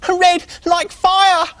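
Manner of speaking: very high, drawn-out pig voice; little pig frightening the squirrel, very slow and very high; spooky slow mock-scary. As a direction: stretch it out to frighten. Pig's final scare.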